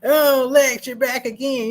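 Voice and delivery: high, loud mock-cheery greeting; cartoonishly casual cops greeting Lex; movie cops. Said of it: "the cops are just like".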